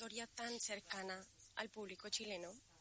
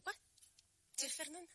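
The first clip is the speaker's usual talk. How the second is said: higher, mock astonished gasp of recognition; surprised double take; 8 semitones higher than usual. Recalling seeing Fernanda in a scene, surprised. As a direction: act stunned to recognise her friend. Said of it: "yo te vi ahora en la escena y yo".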